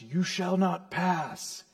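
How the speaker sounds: lightly acted wizard's stand; imitating Gandalf's shout. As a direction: draw out each word in restrained defiance. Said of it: "and he shouts".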